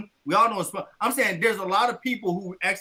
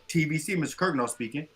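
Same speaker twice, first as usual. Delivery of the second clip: low, soft business greeting; lowered professional phone voice; work phone voice; 5 semitones lower, 6 dB quieter than usual. Shown as a demonstration. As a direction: softly answer the office line. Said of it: "You just heard what I said".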